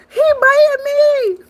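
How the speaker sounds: high-pitched mock cry of pain; imitated scream. Reactor repeats the bitten man's cry, mock scream. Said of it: "heard that man start screaming".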